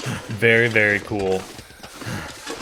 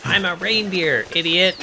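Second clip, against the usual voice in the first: high, mocking deer retort; sassy deer talking back to the player; 8 semitones higher than usual. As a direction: play the deer snapping back, pitched way up.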